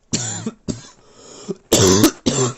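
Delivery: a loud real coughing fit. Real, not acted. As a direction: cough hard, caught off guard. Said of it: "Sorry, damn".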